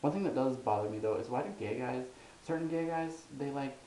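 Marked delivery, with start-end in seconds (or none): none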